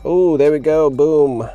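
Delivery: a triumphant burst when it finally works; excited. Real, not acted. The radio finally powers on.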